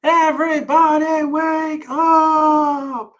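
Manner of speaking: long, high wake-up call held on every syllable; high drawn-out shout. Acted. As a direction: shout it slowly to wake the whole house. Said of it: "and shouted".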